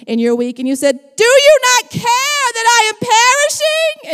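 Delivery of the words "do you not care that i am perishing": high, loud mock panic; panicked cry. Pastor mocking a panicked disciple, loud high wail.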